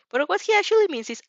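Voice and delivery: ordinary delivery, nothing marked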